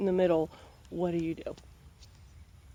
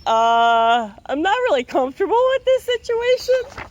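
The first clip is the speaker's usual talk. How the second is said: much higher, put-on uneasy polar bear; 11 semitones higher, 6 dB louder than usual. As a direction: squeak it out like a nervous bear. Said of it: "The polar bear's even like".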